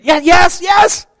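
high, loud, joyful shouting; excited shout. Acted. Healed beggar shouting to his neighbors, overjoyed. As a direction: shout it out, desperate to be believed. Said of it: "you can hear him".

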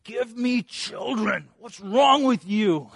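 high, slow, desperate demand; grabbing his lapels. Acted. Rachel clutching Jacob's robe, high desperate demand. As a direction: shake him and demand it. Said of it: "grabbing him by the robe lapels and saying".

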